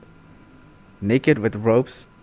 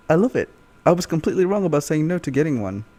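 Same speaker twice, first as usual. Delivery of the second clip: Dan's eager voice; 6 semitones higher, 5 dB louder, faster than usual. Retold or imitated.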